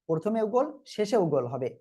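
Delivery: ordinary talk, nothing marked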